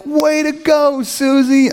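high, drawn-out fake cheer; put-on congratulations. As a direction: overdo the congratulations for a coworker. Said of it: "And outside, you're like".

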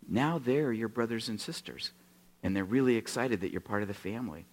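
quiet tender explanation; gentle pastor. Retold or imitated. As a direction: reassure him kindly.

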